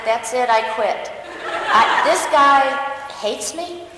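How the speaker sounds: angry quitting. Retold or imitated. Quitting after the interview, loud laughing fury. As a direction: storm off, half laughing.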